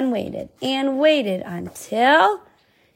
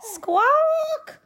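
high screeching parrot cry; parrot squawk. Acted. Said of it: "cried the captain's parrot".

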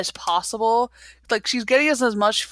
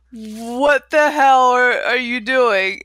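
slow, drawn-out disbelief; playful imitation of stunned boyfriend; mock shocked Derek; 3 semitones higher, 4 dB louder, slower than usual. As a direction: drag out the baffled question. Derek shocked at hazmat suit.

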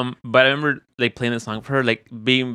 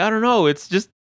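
high wife voice; 11 semitones higher than usual. Retold or imitated. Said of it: "She's like".

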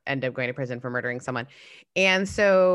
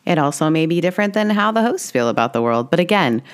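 loud, clear announcement read; disclaimer read; 10 dB louder than usual. Acted.

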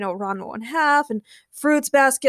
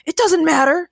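high, loud, proud character voice; loud proclamation; 6 semitones higher, 6 dB louder than usual. A narrator's reading. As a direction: proclaim it pompously. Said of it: "he proclaimed quite loudly".

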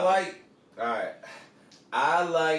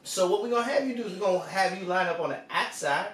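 Southern coach drawl. Pretend. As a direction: play a folksy Southern coach sizing up a recruit. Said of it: "Nick says".

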